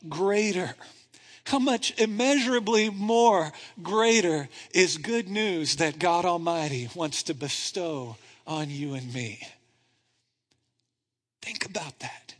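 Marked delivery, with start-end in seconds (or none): 5.0-12.4 fading down to a near hush; quiet awe. Real, not acted. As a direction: draw them in softly. Preacher drops to hush.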